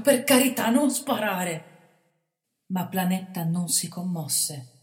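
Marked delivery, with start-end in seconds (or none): 0.0-1.7 loud, very slow begging; terrified boy begging the bandit; desperate plea. A narrator's reading. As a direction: beg him not to shoot. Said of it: "con accento disperato".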